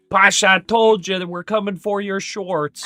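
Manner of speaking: hammed-up smug taunt; mocking chat voice. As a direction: rub it in like a smug chat user. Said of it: "They're gonna be like".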